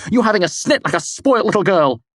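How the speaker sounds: high, loud scornful jab; angry taunt. A narrator's reading. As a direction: belittle her. Argument with Denna.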